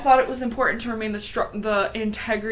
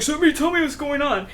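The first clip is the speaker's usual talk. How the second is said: fast, higher pushy repeat; Tiger Claw demanding; 6 semitones higher, faster than usual. Retold or imitated. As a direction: rattle off the demand impatiently. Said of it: "He's like".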